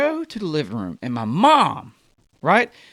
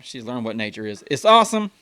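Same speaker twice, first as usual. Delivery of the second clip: normal talking voice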